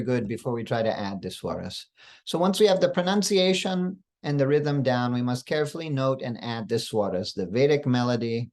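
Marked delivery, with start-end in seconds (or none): none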